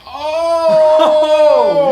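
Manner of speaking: loud, high laughing oh; stunned oh. Retold or imitated. Reacting to the bold girlfriend, loud laughing oh. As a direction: dodge it like the Matrix.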